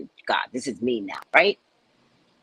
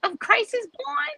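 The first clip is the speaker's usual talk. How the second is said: shocked disbelief. Retold or imitated. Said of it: "And I was like".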